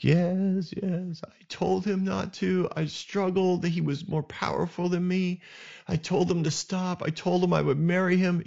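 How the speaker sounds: high, plaintive retelling through mock tears; imitated weeping. Sister tells her brother what was done, tearfully pleading. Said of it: "she's just weeping".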